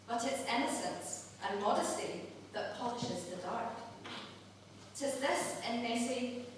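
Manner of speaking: soft, measured Scots verse reading; poem recital. Acted. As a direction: recite it tenderly, line by line. Recites a Burns love poem.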